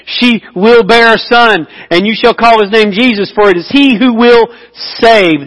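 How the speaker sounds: lifted scripture reading; scripture read aloud. Acted. Reading the angel's promise aloud.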